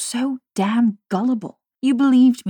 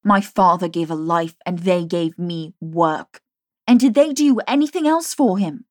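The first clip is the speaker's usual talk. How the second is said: furious; 3 semitones higher, 7 dB louder than usual. A narrator's reading. Jamie's furious tirade.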